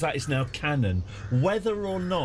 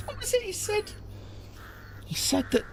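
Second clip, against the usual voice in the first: high squeak; 5 dB quieter than usual. Real, not acted.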